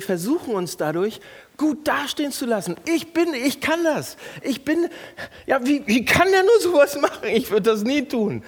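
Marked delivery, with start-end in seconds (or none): 2.9-8.4 high, loud, smug indignation; self-righteous boast. Shown as a demonstration. Pastor mocking the congregation's pride. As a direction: brag and condemn at once.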